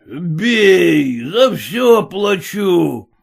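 put-on loud, slow drunken shout. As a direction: roar the order to smash. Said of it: "крикнул проф. Семёныч".

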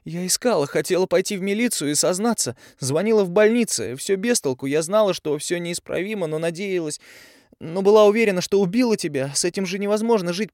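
high and loud, near sobbing, rising to a yell; girl venting her guilt and rage; tearful angry outburst. A narrator's reading. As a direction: pour it all out through tears, then curse him. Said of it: "Тави всхлипнула, сердито вытерла глаза".

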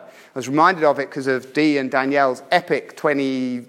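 ordinary talk

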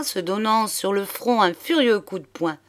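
ordinary delivery, nothing marked

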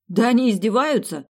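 loud, fed-up cry; exasperated outburst. Acted. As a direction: blurt it out in annoyance. Woken at night, exasperated.

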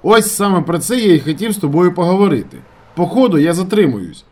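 loud, high phone voice; shouting. A narrator's reading. Narrator telling his friend of a delay, shouting. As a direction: shout the news down the line. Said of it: "кричав я йому".

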